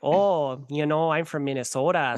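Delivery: broad Minnesotan "oh, you know"; imitated small-town Minnesota speech; Minnesota accent. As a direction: put on a folksy Minnesota accent. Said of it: "the way they talk. Like, they're like".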